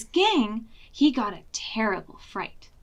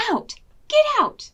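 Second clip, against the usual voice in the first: shout; 9 dB louder than usual. Acted. Granny chasing dog with broom.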